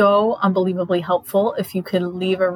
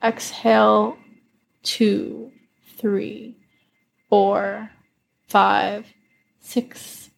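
calm counting through slow breaths; guided breathing. Acted. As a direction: count each breath in and out calmly. Counts guided breathing over music.

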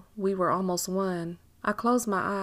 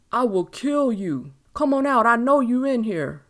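loud acted threat, building higher; threatening yell; 3 semitones higher, 7 dB louder than usual. A narrator's reading. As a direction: yell menacingly to flush someone out. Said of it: "he yelled".

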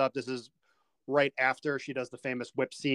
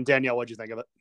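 ordinary talk

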